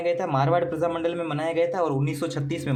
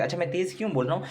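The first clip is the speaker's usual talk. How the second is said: hurried quick delivery; fast talking; faster than usual. Real, not acted. Teacher admits speaking fast to save class time, rushed. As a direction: rattle it off quickly. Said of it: "मैं थोड़ा सा तेज बोल रहा हूँ".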